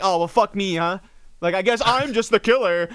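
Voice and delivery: normal talking voice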